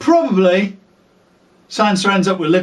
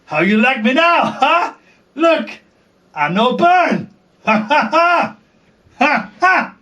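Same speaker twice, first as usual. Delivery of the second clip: high, mocking triumphant taunt with laughs; gloating Daenerys; 6 semitones higher than usual. Daenerys taunting the Dothraki, high gloating laugh. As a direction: crow over them like a smug queen. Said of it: "she'll be all like".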